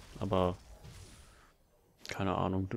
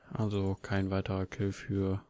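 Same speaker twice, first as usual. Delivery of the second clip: usual speaking voice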